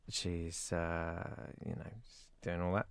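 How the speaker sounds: soft, low, can't-be-bothered mumble; unbothered mumble. Real, not acted. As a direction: trail off, too lazy to care. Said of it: "I can't really be bothered".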